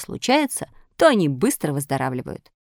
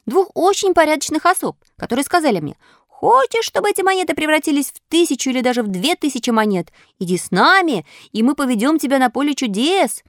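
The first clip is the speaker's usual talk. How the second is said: high-pitched animated puppet voice; excitable puppet storyteller; 9 semitones higher, 3 dB louder than usual. Acted. Puppet telling fairy how robbers caught him, excitable. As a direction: recount it eagerly, voice pitched up. Puppet recounts his adventure.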